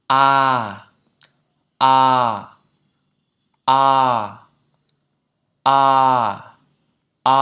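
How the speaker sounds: long, loud open "A", drawn out; sustained "A" vowel drill. Shown as a demonstration. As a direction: hold each "A" out, loud and steady. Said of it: "Sau đó kéo dài nó ra như sau".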